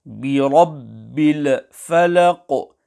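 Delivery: "الْفَلَقِ" with the first L bounced into a short "uh"; wrong qalqalah on Lam. Shown as a demonstration. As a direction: echo the still Lam in "الْفَلَقِ", as "bil-uh-falaq". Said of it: "make qalqalah on Lam Sakina and recite".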